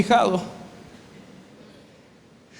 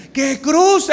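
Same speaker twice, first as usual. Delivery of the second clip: a couple shouting in a car; exasperated shout; 9 semitones higher than usual. Retold or imitated. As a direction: shout it at a clueless husband. Wife insists, escalating.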